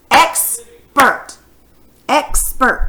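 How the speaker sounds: ex-pert split into two clapped beats; teacher clapping out "expert" in two parts; clapped syllables. Shown as a demonstration. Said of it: "We'd clap it like this".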